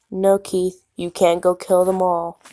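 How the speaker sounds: ordinary delivery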